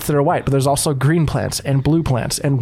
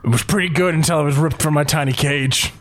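gruff huffing badger. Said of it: "talking in weird badger voice".

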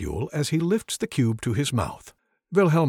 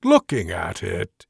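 growl; 6 dB louder, slower than usual. Acted. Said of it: "he growls".

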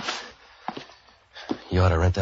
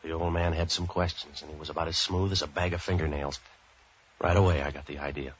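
hard-boiled narration; 5 dB quieter than usual. A narrator's reading. Detective narrating his case to the listener, dry noir narration. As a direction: tell it like a tired private eye looking back.

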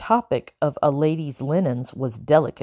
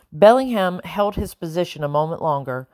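usual speaking voice

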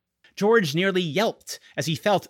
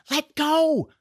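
urgent; 13 semitones higher, 4 dB louder than usual. A narrator's reading. Boy tugging dragon off duvet.

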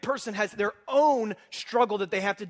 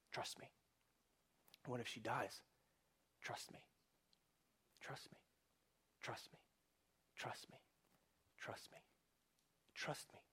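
barely audible, whispered trust me; soft voice of God; 9 semitones lower, 24 dB quieter than usual. Acted. Pastor giving God's quiet reassurance to a worried believer. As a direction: murmur it gently, over and over.

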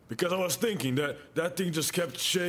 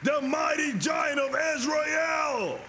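fiery preaching; 6 semitones higher than usual. Acted. Preacher declaring God's protection to the church, fiery preaching. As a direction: thunder it from the pulpit.

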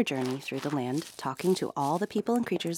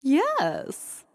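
queen voice; 11 semitones higher, 5 dB louder than usual. A narrator's reading. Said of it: "answered the queen".

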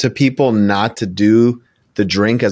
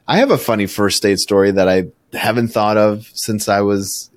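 ordinary delivery

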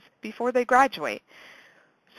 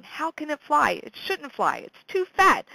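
puzzled wonder; 6 semitones higher than usual. Retold or imitated. Puzzled about bee flight.

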